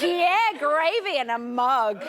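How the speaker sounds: lightly voiced older crowd, drawn out; slow mock approval from the older lot; older people. Host speaks for older people who know gravy drinks, lightly acted approval. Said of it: "All us older people are like".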